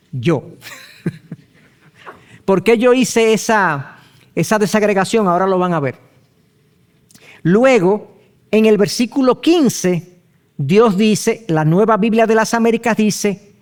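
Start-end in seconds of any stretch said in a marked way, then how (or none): none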